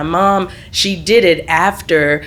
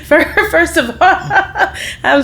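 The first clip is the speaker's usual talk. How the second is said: words broken by high laughter; cracking up mid-sentence; laughing; 11 semitones higher than usual. Real, not acted. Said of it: "I had to laugh at that".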